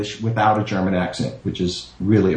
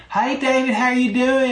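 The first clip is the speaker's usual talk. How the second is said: put-on thick Southern accent. Sister yelling hello on the phone, high Southern accent. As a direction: imitate a relative's thick Southern twang, pitched way up. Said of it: "my family has thick accents".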